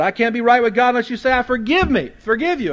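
pleading cry. Retold or imitated. Young man pleads for forgiveness.

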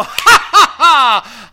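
loud, high mocking cackle of the devil; devil's laugh. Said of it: "the devil himself would go".

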